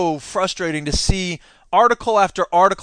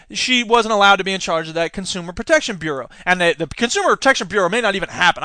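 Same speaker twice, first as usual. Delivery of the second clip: ordinary delivery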